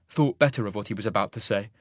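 usual speaking voice

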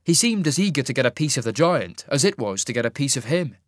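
ordinary delivery, nothing marked

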